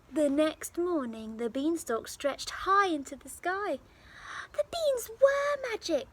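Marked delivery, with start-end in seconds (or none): 4.5-6.1 put-on much higher, louder boyish exclaim; excited cry. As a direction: burst out with delight. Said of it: "Jack cried".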